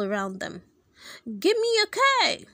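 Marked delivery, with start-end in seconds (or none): 1.4-2.5 high, loud cheerleader chant; cheer shout. Acted. Cheerleaders call to the stadium fans, high loud shout. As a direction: yell it to the crowd. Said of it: "shouted the cheerleaders".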